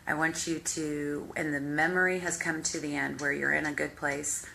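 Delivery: usual speaking voice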